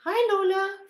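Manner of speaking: high, loud cooing hello to the puppy. Real, not acted. Speaking to the puppy.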